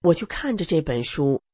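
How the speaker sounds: usual speaking voice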